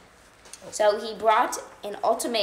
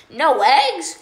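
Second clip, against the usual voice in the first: much higher, loud shocked cry; cried out; 7 semitones higher, 10 dB louder than usual. Acted. Farmer Brown reading the note, loud shocked cry. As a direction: shriek it in disbelief. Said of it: "cried Farmer Brown".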